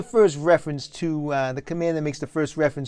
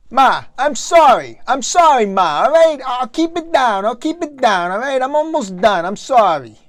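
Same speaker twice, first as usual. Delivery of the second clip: loud apology; 7 semitones higher, 8 dB louder than usual. Acted. Son apologises loudly to mother.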